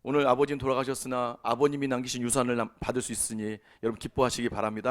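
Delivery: low, level imitation of a pastor at a funeral; mock solemn pastor, pitched well down; funeral pastor's address. Pastor addressing mourners about an inheritance, in a low official voice. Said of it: "목사님 이런 얘기 하시더라고요".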